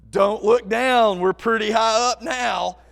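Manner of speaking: slow, loud, drawn-out warning. Retold or imitated. Man to the girl atop the coaster, loud warning. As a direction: call it out slowly over the ride.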